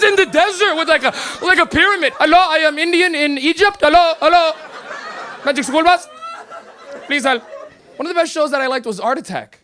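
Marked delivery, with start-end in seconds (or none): 2.2-7.4 put-on lost kid calling out in an Indian accent; hammed-up Indian accent, hopeful hellos. Comic plays cartoon kid stranded in the desert, put-on Indian accent. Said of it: "he has an Indian accent".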